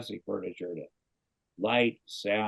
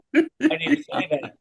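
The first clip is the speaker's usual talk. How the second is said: much higher, loud, through laughter; laughing; 8 dB louder than usual. Retold or imitated. Cameron asking the executives, mock voice broken by laughter. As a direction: squeak it out while cracking up. Cameron's retold indignant question.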